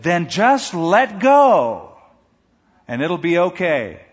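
very slow, solemn divine command; slow voice of God. Acted. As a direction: speak as God from heaven, slowly. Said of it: "And then the voice said".

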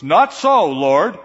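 indignant, punched protest; defiant refusal. Acted. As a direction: blurt out a flat-out objection. Peter defiantly refuses the Lord's command.

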